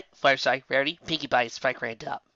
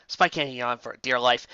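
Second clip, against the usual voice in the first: ordinary delivery, nothing marked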